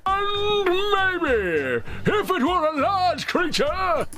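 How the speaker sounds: high, slow put-on creature voice; large creature voice. Shown as a demonstration. Tutor demos a large creature voice, high and slow. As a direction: draw it out like a big creature. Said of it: "It'd speak like this".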